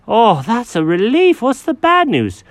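high, fussy cat voice; Jenkins the cat. Acted.

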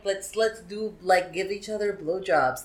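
ordinary talk, nothing marked

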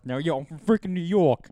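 loud, high mock New York accent; hammed-up New York accent, teasing a friend. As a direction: play it up as a tough New Yorker. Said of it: "That sounds like we're from New York".

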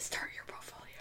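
very soft whispered prompt; hushed, barely-there whisper. Real, not acted. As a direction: whisper it as a quick aside. Whispered aside to the co-host.

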